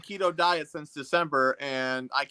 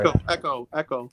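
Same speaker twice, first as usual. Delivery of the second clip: mock echo, each repeat fading softer; fake echo; 3 semitones lower, 4 dB quieter than usual. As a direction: voice an echo that dies away. Teasing about the echo.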